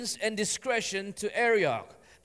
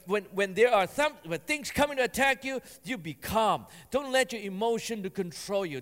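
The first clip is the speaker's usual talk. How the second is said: normal talking voice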